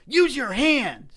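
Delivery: loud, high, drawn-out mock scolding; indignant scolding. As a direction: imitate an outraged stranger telling someone off. Retold stranger scolding him at restaurant.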